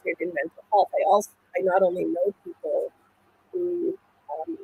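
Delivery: muffled, as if through a hand. Real, not acted. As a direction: voice muffled by the mic. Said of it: "your mic went a bit, uh, muffled".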